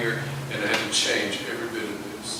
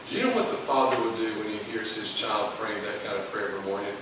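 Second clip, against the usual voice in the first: normal talking voice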